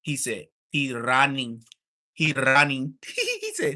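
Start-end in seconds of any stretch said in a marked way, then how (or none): 0.7-2.9 put-on louder Russian-accented "He running" said twice; hammed-up Russian accent, blunt and heavy; mock Russian accent. Mocking a fighter who runs, in mock Russian accent. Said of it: "I'ma take my Russian accent".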